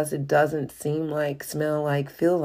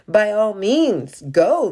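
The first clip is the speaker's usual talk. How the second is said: louder, slower royal go-ahead; the king urging him to go, slow and loud; king's eager reply; 5 semitones higher, 5 dB louder, slower than usual. Acted. King of Aram answering Naaman, louder and slow. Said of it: "the king of Aram replied".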